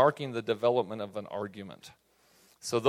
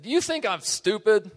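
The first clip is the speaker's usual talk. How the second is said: sharp, higher, louder rhetorical jab; upset parent; 9 semitones higher, 5 dB louder than usual. Retold or imitated. Exasperated parent scolding the kids, mock angry rhetorical question. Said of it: "I'm talking to my kids and I'm really upset".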